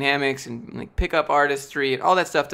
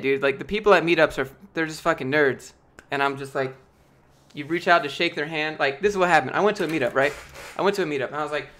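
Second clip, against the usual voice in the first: normal talking voice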